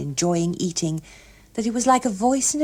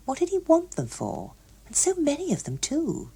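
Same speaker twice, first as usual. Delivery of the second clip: ordinary delivery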